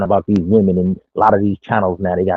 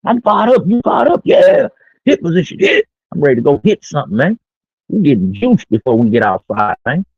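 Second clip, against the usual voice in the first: high, pumped-up locker-room yell; pregame hype; 8 semitones higher than usual. Retold or imitated. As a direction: fire up the team before the Super Bowl. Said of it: "Like you getting ready to play the Super Bowl".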